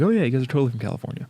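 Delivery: put-on quick breezy California accent; mock California accent. Friend mimics outsiders hearing California accent. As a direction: rattle it off like a California local. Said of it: "when did we develop California accents".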